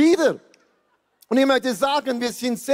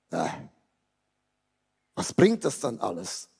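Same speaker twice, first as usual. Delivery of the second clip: resigniert; 12 semitones lower, 6 dB quieter than usual. Acted. Hiob resigniert, zitiert.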